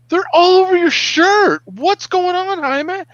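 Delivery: very high, loud alarmed cry; wife shocked at husband's bug-covered shirt; retold alarmed wife. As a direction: shriek it at the sight of the shirt.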